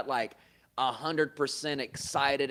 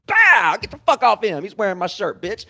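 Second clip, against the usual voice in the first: much higher and louder, yelling in the fight; reenacted brawl shout; 8 semitones higher, 14 dB louder than usual. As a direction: shout like you're mid-brawl.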